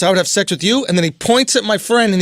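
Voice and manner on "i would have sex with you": low mock Boston come-on. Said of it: "he has a Boston accent".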